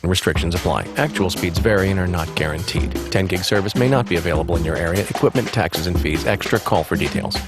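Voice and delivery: fast legal disclaimer. Acted. Ad disclaimer read at end.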